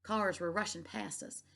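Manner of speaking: plain reading voice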